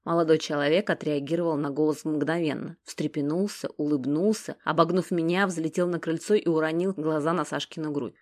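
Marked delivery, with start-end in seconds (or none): none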